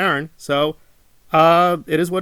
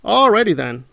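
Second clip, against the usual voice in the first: sarcastic alrighty; 5 semitones higher, 4 dB louder than usual. Retold or imitated. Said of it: "repeated catchphrase".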